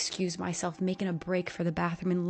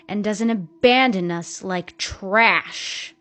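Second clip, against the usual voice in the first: slow, loud, cutting line; bitter jab; 11 dB louder, slower than usual. A narrator's reading. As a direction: spit out the insult slowly. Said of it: "And with a shift in tone".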